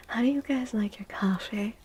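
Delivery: normal talking voice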